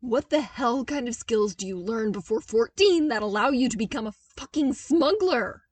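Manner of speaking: loud outraged yelling; outraged shout. Acted. Zev yelling at Luke about becoming a smuggler. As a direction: yell it, furious and appalled.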